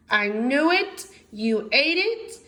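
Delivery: high, loud accusing scold; angry scientist scolding her assistant. Acted. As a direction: tear into him, exasperated. Franny scolds Igor for eating bomb.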